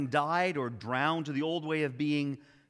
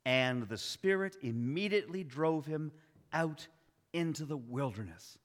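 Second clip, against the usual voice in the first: "And the Spirit immediately drove him out into the wilderness" slowed, each word placed; slow and quieter, lingering on "out"; slow, deliberate reading; 4 dB quieter than usual. Shown as a demonstration. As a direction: read it slowly, weighing each word. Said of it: "That's the part that matters to me".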